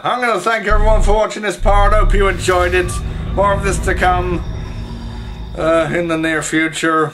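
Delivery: loud, upbeat sign-off; cheerful channel outro; YouTube outro. Acted.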